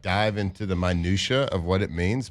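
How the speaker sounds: ordinary talk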